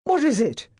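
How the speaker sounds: high, loud alarmed cry. A narrator's reading. Master crying out to the footman. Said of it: "he cried".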